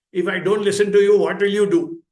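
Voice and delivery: loud, fast, looking down at mother; defiant challenge. Retold or imitated. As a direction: square up to her with a loud, quick threat. Grown son defying mother.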